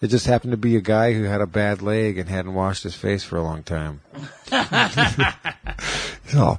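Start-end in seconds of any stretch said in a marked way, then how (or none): 4.5-6.2 raspy zombie wheeze; zombie noise. Pretend. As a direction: breathe like a groaning zombie. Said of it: "that's my zombie noise".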